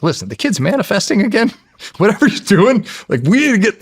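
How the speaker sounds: high, amused insistence; retold excited urging. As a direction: act out talking the agent into it, pitched right up. Retold excited call to the agent.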